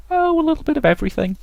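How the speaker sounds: very high falsetto character voice; airy artist character. Acted. Artist answering an admirer, in a high put-on voice.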